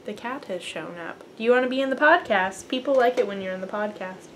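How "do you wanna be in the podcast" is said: usual speaking voice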